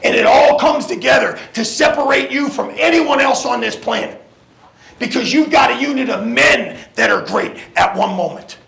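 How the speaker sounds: rising, shouted locker room speech; halftime pep speech. Acted. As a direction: fire the team up. Coach building to shouted pep talk.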